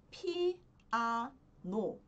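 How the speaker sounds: "piano" as three separate syllables, pi, a, no; Korean word sounded out. Shown as a demonstration. Teacher introducing the word "piano" in Korean, slow syllable-by-syllable model. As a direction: draw out each syllable of "piano" slowly.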